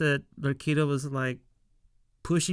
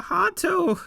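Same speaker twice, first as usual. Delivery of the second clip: excited alarm; 7 semitones higher, 5 dB louder than usual. Retold or imitated. Panicked warning in retold scene.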